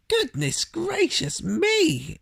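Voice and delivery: high, slow startled faun cry; exclaimed. A narrator's reading. Faun startled by Lucy, exclaiming high. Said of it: "exclaimed the faun".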